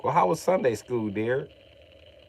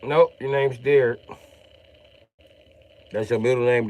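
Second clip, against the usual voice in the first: ordinary talk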